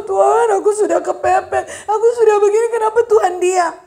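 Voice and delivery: high, loud cry to God; acted desperate plea. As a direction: plead loudly like someone cornered.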